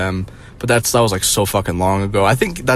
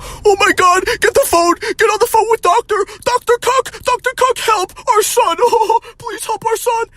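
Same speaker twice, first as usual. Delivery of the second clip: much higher, frantic panicking parent. Retold or imitated. Parents panicking over depressed son, mock shrill and frantic. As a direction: squeal it like a hysterical boomer mom.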